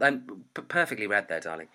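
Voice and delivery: ordinary delivery, nothing marked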